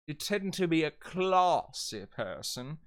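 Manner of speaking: hammed-up British with "classy" as "clahssy"; fancy British accent, drawing out "classy"; High British. Shown as a demonstration. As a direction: put on a grand British accent. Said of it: "class will turn into class".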